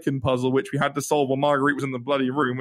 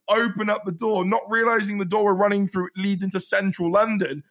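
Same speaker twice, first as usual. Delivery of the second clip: ordinary talk, nothing marked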